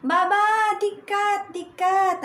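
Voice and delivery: put-on high, loud child's call of warning; little daughter calling to father on window, high loud shout; imitating child's shout. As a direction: call out like a little girl warning her dad. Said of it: "sumigaw siya ng".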